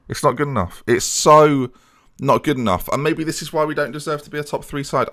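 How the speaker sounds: usual speaking voice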